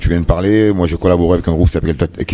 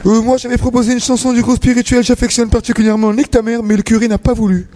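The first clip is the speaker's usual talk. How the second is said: very high choirboy voice; squeaky little boy voice; child voice. Acted. Choirboy role, pitched up.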